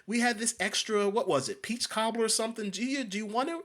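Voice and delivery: imitating an overly sweet waitress; mock sweet server. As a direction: parody a syrupy southern waitress offering food.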